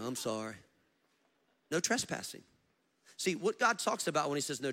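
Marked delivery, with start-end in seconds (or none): 0.0-0.6 low, soft imitated apology; quietly sheepish, mimicked; retold repentant apology. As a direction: play him humbled and subdued. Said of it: "He's repenting all the way".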